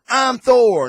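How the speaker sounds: put-on high, loud proud kid boast; kid playing Thor. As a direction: crow it like a kid in the yard. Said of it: "there's a kid gonna go".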